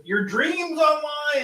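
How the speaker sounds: high, long-drawn-out quote; mock dramatic quote. Repeating an odd viewer comment, mock dramatic. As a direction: stretch it out high like a silly proclamation.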